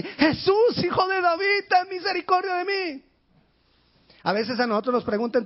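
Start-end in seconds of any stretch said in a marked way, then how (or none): 0.0-3.0 very high, loud cry for mercy; shouting. Acted. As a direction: scream it out with emotion. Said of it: "lo dijo gritando".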